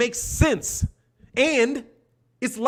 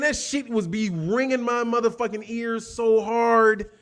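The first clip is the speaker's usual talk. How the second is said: ordinary delivery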